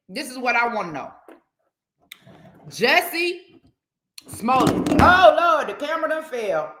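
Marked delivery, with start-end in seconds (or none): 2.7-5.0 "Jussie" pitched up high, then "Smollett" drawn out and louder; dramatic name call. Acted. 5.0-6.7 sudden loud, high "Oh, Lord"; startled. Real, not acted. Camera falls mid-talk.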